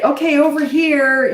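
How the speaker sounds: put-on high, loud and slow, calling the child over; bossy calling out. Shown as a demonstration. Mom demonstrating how not to call a toddler, high loud call. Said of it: "you don't talk like that".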